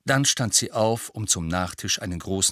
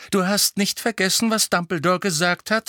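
sharp warning; 10 semitones higher, 4 dB louder than usual. A narrator's reading. Mother continuing the sharp rebuke.

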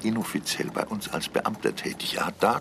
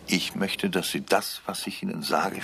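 ordinary talk

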